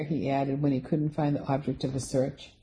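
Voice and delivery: ordinary delivery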